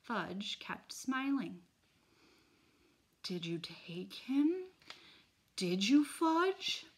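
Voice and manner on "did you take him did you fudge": slow, rising, pressing question; losing patience. Acted. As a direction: push harder, calm cracking. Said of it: "I asked not so calmly".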